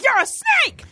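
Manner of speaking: sudden high, accusing cry; hammed-up shop clerk snapping at a customer; mock outburst. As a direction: fling the insult up high in mock outrage. Imagined customer service outburst.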